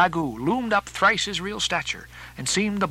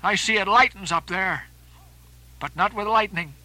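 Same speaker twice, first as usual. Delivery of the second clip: lightly raised, higher-pitched sailor's voice; storm-tossed sea captain; 5 semitones higher than usual. Acted. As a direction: call it out over the wind. Character speaking aloft in the storm.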